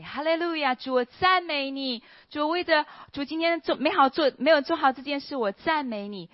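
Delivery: high, loud joyful praising of the Lord; loud praise. Retold or imitated. Walking home, praising God aloud in a high, loud voice. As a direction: call out praise to God at the top of your voice. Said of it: "一边赞美".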